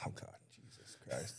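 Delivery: breathy whispered "oh God" under his breath; dread whisper. Retold or imitated. Retelling his dread at the party, whispered. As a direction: mutter it low so nobody hears. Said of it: "And I'm like".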